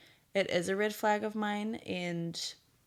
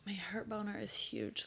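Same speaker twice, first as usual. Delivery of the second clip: whisper; 8 dB quieter than usual. Real, not acted. Confiding aside about babies.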